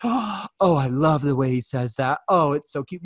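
gasp, then swoon over it; hammed-up fan adoring an accent; gushing admirer. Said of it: "You all should be like this".

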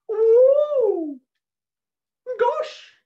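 very high, loud awed gasp; amazed exclamation. Acted. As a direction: gasp in wonder. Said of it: "exclaimed Patrick".

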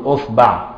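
أصبع said usbaʕ with u on the hamza and a on the b; vowel variants. Shown as a demonstration. Teacher to students, أصبع said usbaʕ. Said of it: "يعني بضم وفتح وكسر الهمزة".

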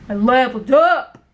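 punchy mock boast rising on "up"; mocking coworker's brag. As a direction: mimic his boast, hitting "up" harder. Coworker's brag repeated, mocking, louder.

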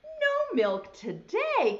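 high, loud, slow wail; crying out. Acted. Farmer wailing over his lost milk, loud and slow. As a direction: Farmer Brown cries out in dismay. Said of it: "cried Farmer Brown".

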